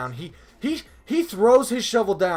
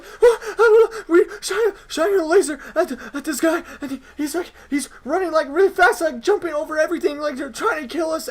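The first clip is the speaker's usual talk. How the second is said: very high, loud mock-hysterical wail; freaking out; 11 semitones higher, 6 dB louder than usual. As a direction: imitate a sobbing kid babbling in panic. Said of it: "like, freaking out".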